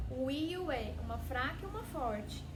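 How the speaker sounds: ordinary delivery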